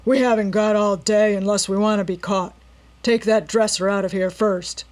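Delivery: gruff gang leader growling orders; growled. A narrator's reading. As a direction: snarl the order at the crew. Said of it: "he growled".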